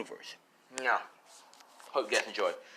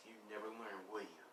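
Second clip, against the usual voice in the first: much softer, lower, quietly taunting; soft menacing taunt; 4 semitones lower, 21 dB quieter than usual. Acted. Villain quietly taunting the fallen hero, soft and menacing. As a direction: murmur it down at a beaten foe.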